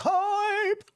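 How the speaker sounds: high squealed hype shout; hyped cheer. Retold or imitated. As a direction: squeal the word like an excited fan. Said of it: "He's like".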